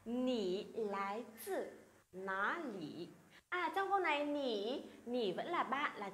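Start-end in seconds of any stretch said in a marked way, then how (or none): 0.1-3.1 slow, careful Mandarin question; slow, syllable by syllable Chinese demo; slow model reading. Shown as a demonstration. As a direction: read it out slowly for students to copy. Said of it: "Đọc lại nào".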